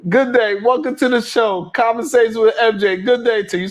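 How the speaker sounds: high, loud hammed-up host greeting; mock show host. Host opening his show in a hammed-up announcer voice. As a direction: play a cheesy TV host welcoming viewers.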